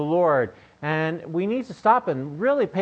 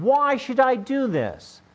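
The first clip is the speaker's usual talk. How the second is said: put-on higher, slow defiant kid's question; challenging child; 9 semitones higher, slower than usual. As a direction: push back like a child testing a parent. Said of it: "they're challenging".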